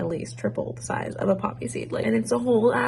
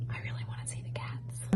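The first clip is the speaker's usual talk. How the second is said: very soft whispered longing; wistful whisper; 13 dB quieter than usual. Real, not acted. Wistful aside about missing home.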